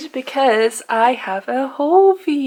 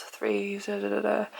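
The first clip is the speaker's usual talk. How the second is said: muttering to self; 10 dB quieter than usual. Real, not acted. Counting tabs under breath.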